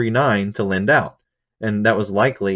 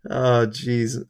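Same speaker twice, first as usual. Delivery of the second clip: ordinary delivery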